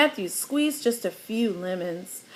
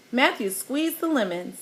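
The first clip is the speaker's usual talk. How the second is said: usual speaking voice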